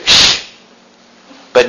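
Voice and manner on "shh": drawn-out shushing sound; shh, keep it secret; secretive hush. Acted. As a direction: hush them as if guarding a secret. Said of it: "it's a secret".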